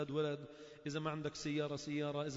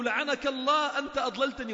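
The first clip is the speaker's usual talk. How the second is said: loud, higher, angry curse at a companion; cursing accusation; 7 semitones higher, 9 dB louder than usual. Acted. One damned man cursing his companion in Hell, loud and angry. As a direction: spit the blame at him. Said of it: "هذا يقول له".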